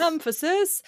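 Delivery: Emphasis said high and loud with a rising end; emphatic repeat. Pretend. Co-host echoing the word for comic emphasis. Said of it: "just for emphasis".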